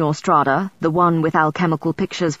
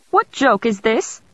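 higher, slowed, irritated snap; snapping Emperor; 6 semitones higher than usual. Acted. Emperor snaps at his courtiers over the book, higher and irritated. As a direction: bite the question off. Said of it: "Rudolph snapped in German".

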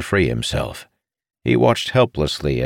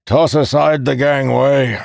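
raised, forceful command; commanding order; 9 semitones higher, 5 dB louder than usual. A narrator's reading. Commander ordering soldiers to drop the gangway, commanding. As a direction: call it out so soldiers obey. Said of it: "Calamir ordered".

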